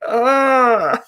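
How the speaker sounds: high mock panicked cry; mock scream. Washing the dog in the sink, mock panicked cry. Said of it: "and I'm like".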